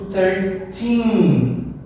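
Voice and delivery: put-on "thirteen" with a long, stressed final TEEN; thir-TEEN, weight on the end. Shown as a demonstration. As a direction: draw out and punch the "teen" in "thirteen". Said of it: "a sílaba tônica, a stressed syllable".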